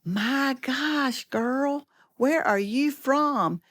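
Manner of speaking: high, drawn-out disbelief; stretched-out, high-pitched mock astonishment; astonished pageant director. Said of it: "the director looked at me and said".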